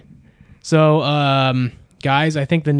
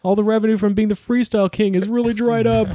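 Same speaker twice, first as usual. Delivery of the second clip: higher put-on rapper voice; [name] impression; 6 semitones higher than usual. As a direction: lament it in a raised rapper voice. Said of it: "a great [name] impression".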